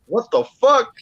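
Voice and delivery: high shocked outburst; shocked mother. Retold or imitated. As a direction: recoil in disbelief at the sight. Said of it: "she's like".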